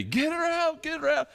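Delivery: high, frantic mock cry of a panicking mother; mock panicked shriek. Wife panicking at laughing husband, high frantic mock shriek. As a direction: shriek it like a frightened mom, hammed up. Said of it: "getting mad at me".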